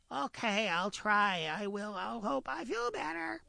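high, whiny rabbit with a cold; high pleading rabbit voice; stuffy-nosed rabbit. Acted. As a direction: play a sly rabbit faking a cold. Rabbit promises to try.

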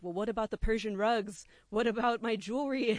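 a hint of panicked parents; fussy parents. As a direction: parents fussing over valuables.

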